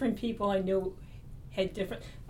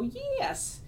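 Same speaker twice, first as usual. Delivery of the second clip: high, drawn-out "Yes"; long squeaky-high affirmative. Retold or imitated. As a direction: stretch out a high-pitched yes. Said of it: "and I'd go".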